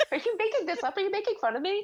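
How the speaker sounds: high, quick, laugh-filled questioning; laughing disbelief. Real, not acted. Co-host doubting the movie list is real, laughing. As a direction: protest incredulously through real laughter.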